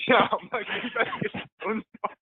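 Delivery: high voice breaking up with laughter; laughing. Real, not acted. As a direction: squeeze the words out while laughing. Laughing along with the listener's joke.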